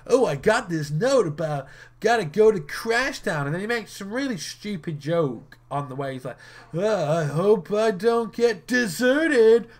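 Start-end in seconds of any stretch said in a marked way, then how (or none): 0.1-3.4 a higher, louder mock of the dub hero; lightly sending up the dub line; mocking dub Yusei. Vlogger mocking the dub's Yusei explaining himself, lightly higher and louder. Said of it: "explaining why he's there". 6.7-9.7 high, loud, very slow dopey joke voice; mocking stupid joke. Vlogger mocking the dub hero's stupid desert pun, slow and dopey. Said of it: "Something stupid like that".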